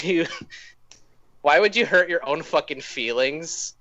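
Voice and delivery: ordinary talk, nothing marked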